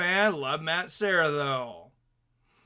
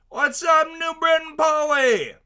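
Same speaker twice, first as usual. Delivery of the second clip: high, loud shout-out; hyped greeting; 11 semitones higher, 6 dB louder than usual. Acted. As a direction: call out the viewer's name like a hype man. Greeting a viewer by name.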